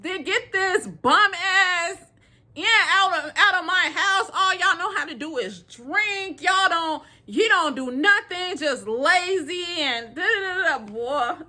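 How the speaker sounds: high-pitched mock scolding rant; mock cursing out. Mother retold yelling from the stairs, high mock scolding. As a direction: rant like a fed-up mama. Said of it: "cursing them out".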